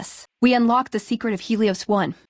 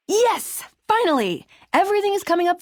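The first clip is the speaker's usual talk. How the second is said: high, delighted cheer; thrilled outburst; 9 semitones higher than usual. Acted. Companion overjoyed that the plan finally suits her, cheering high. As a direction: burst out with joy.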